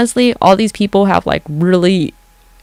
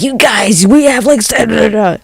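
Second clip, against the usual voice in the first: panicked warning; 5 semitones higher, 3 dB louder than usual. Pretend. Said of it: "and just being like".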